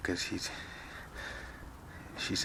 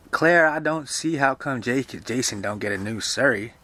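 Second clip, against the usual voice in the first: caretaker's raised-pitch complaint; exclaiming caretaker; 4 semitones higher, 5 dB louder than usual. Acted. Voices Dilsey complaining.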